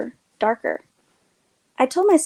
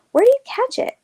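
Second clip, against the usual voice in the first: much higher curious question; eager question; 13 semitones higher, 3 dB louder than usual. A narrator's reading. Narrator eager question to Mina.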